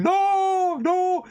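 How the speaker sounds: very high, loud panicked shriek; bystander screaming no. Retold or imitated. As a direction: shriek it like an onlooker losing it. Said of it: "that woman is going".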